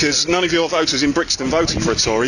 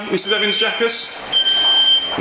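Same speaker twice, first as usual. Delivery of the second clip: pushy shouted reporter questions; 6 semitones higher, much slower than usual. Real, not acted. Pointed drawn-out name to silent politician.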